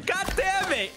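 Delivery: high exasperated cry; frustrated outburst. Real, not acted. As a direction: snap in frustration. Frustrated grabbing item.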